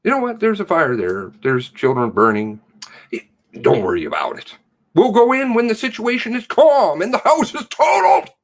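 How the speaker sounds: a sneering, high imitation of lazy police; mock them as they shrug off the fire. Mocking police voice.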